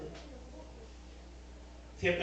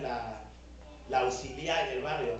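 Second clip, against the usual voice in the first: normal talking voice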